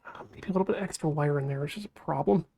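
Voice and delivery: fast whispered aside; whispering. Real, not acted. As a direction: mutter it fast under your breath. Muttering while working.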